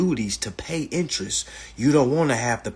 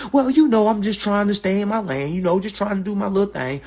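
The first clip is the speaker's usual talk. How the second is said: high, louder, meek little voice; mock humble person; 8 semitones higher, 5 dB louder than usual. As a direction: parody a timid, humble nobody. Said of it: "you ever heard a humble person say".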